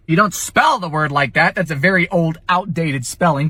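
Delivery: normal talking voice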